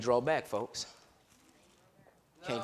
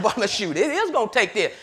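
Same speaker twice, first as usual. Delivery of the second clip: ordinary talk, nothing marked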